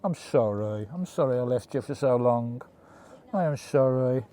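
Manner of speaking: tender apology. Real, not acted.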